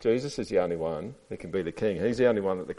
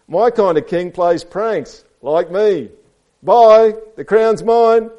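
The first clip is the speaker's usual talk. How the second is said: high, loud prankster voice; gleeful storybook ape; cheeky ape; 12 semitones higher, 14 dB louder, much slower than usual. A narrator's reading. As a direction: crow over snatching the prize. Reads Ape's speech to children.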